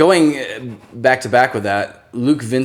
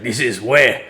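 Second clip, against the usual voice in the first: put-on terrible French accent; slower than usual. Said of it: "terrible French accent".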